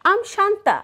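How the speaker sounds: ordinary delivery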